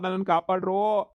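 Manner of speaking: ordinary talk, nothing marked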